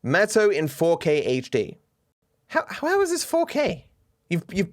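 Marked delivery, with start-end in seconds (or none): 2.5-3.9 high-pitched baffled question; puzzled, high-voiced disbelief; incredulous. Real, not acted. Disbelief at a bad picture edit.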